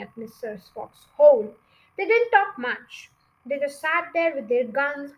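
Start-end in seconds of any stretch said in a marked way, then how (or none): none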